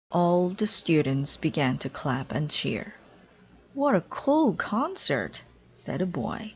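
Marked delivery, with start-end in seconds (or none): 3.7-5.4 put-on high, slow child voice; excited boy. A boy cheering after the concert, high excited kid voice. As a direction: gush like an amazed kid.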